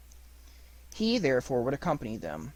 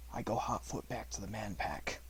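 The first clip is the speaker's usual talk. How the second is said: quiet; 4 semitones lower, 12 dB quieter than usual. Acted. Mowgli declares plan.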